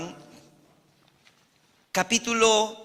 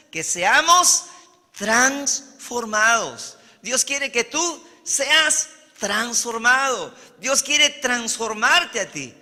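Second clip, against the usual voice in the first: higher, forceful preaching with weighty pauses; emphatic preaching; 4 semitones higher, 4 dB louder than usual. Real, not acted. Preacher telling the congregation God wants them transformed, emphatic preaching. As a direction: drive it home to the congregation, stretching the key word. Preacher drives home the point.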